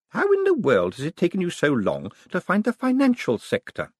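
mock the honest handshake; old lady, amused. A narrator's reading. Old lady mocking Moist's handshake, high and amused. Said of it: "Missus Lavish laughed".

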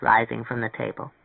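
ordinary delivery, nothing marked

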